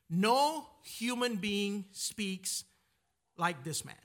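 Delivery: slow, weighty, every word drawn out; awed quote. Retold or imitated. Temple guards telling the leaders, slow and awed. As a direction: let it land slowly, in awe. Guards report Jesus's words.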